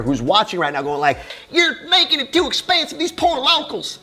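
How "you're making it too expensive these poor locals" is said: very high, mocking Southern whine; Southern accent. Commenter moaning about prices for locals, Southern accent. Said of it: "why you have a Southern accent like that".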